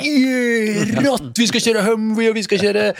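high-pitched, eager retelling of big plans; excited kid. Retold or imitated. Retelling a friend's wild trip plans, eager and high. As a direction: pump it up like a kid listing adventures.

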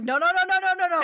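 high, rapid-fire string of protests; panicky Scrooge refusal. Retold or imitated. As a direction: act out desperate refusal. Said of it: "And Scrooge is like".

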